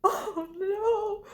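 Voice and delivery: high, breathy horrified "oh no". Retold or imitated. Granddaughter recoiling from the open casket, high and horrified. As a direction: recoil in mock horror.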